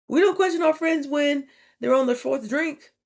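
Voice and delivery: ordinary talk, nothing marked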